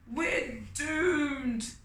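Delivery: high, moaning we're doomed. Acted. Ant moaning at a thousand fruit trees. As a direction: wail it like a doomed little ant. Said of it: "Ant moaned".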